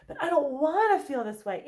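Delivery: protesting. Acted. Anxious person resisting how they feel afterwards, protesting. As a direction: push back against the feeling. Acts out resisting an unwanted feeling.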